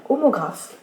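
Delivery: normal talking voice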